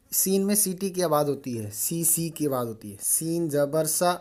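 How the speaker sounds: ordinary delivery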